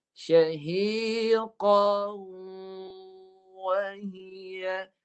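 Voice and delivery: slow chanted Syahiqan wahia; model recitation. Shown as a demonstration. Teacher models the phrase before students repeat. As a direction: chant it slowly for students to copy. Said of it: "Sila baca".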